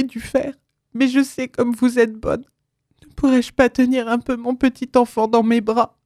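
high, tender supplicating voice; gentle, pleading. A narrator's reading. Repentant mother begging Suzanne to let her hold her child, gentle pleading. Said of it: "tant elle était devenue douce et suppliante".

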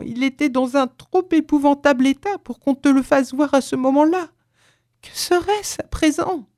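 put-on anguished old man, pitch climbing high; trembling voice. A narrator's reading. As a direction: build from halting to high distress. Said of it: "lui dit d'une voix tremblante". (voix tremblante, angoissée)

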